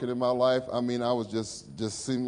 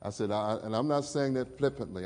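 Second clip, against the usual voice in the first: ordinary talk, nothing marked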